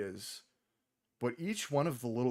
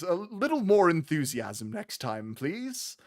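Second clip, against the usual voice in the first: a lightly raised scolding gnome voice; teasing gnome wife; 5 semitones higher, 4 dB louder than usual. Acted. Gnome wife chiding her cart-driving husband, lightly teasing. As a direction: nudge him playfully to sound keener. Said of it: "She goes".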